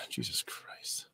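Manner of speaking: breathy whisper, slow, exhausted; a worn-out whispered Jesus Christ; whispered exasperation. Real, not acted. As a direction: sigh it out under your breath in disbelief. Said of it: "exasperated sigh".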